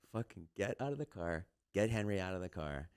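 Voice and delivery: low, softened sneaky recounting; hushed, careful play-by-play; real quiet. Retold or imitated. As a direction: keep it down, as if creeping past someone. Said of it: "like, real quiet".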